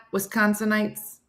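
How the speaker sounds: normal talking voice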